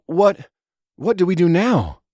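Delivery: flustered. Acted. Elder Mary panicking over what to do, flustered. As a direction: stumble over the question in shock.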